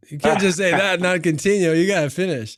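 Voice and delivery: an eager host refusing to let it go; playful insistence. Real, not acted. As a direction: press him to keep talking, half joking.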